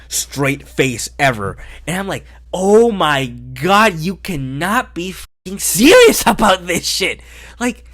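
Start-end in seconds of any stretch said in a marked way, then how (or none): 2.5-7.2 loud, raised-pitch incredulous outburst; exasperated disbelief. Retold or imitated. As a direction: draw out the opening, then protest in disbelief. Acts out his exasperated reaction while watching.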